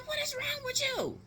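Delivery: very high-pitched exasperated cry; appalled outburst. Retold or imitated. Aunt seeing niece chug vodka, appalled. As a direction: squeal it in disbelief.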